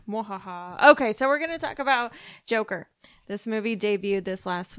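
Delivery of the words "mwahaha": mad scientist laugh. Pretend. Evil laugh after "listen to all of it.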